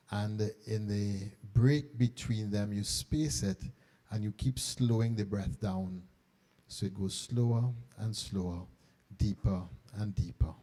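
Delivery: soft, low, unhurried meditation guidance; hushed voice easing the room into calm; guided meditation. Acted. Guiding the breathing slowly.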